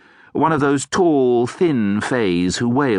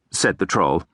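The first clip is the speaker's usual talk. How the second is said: ordinary delivery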